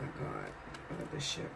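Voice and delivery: awed whisper to herself; hushed whisper; whispered aside. Real, not acted. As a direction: breathe it under your breath at the cards. Reacting to the cards drawn.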